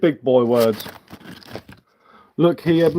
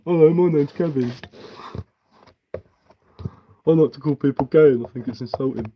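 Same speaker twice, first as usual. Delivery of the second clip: a hint of a dopey voice for the chatter; mock him introducing himself; lightly voiced dopey chatter; mock Gavin.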